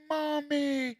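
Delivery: put-on very high, tiny baby calling Mommy; newborn baby voice. Speaker imitates a newborn's first word for the audience, very high baby voice. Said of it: "the little baby just comes out and says".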